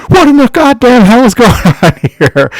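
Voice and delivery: high, loud mock-panicked yell breaking into laughter; acted-out panic, cracking up at the end; terrified outburst. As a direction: imitate a frightened man yelling. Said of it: "completely terrified".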